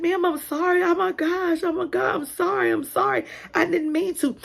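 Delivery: high, pleading panicked sorry; frantic apology. Retold or imitated. Teacher Sally apologizing to the angry mom, panicked. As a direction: beg forgiveness in a rush. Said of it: "She was like".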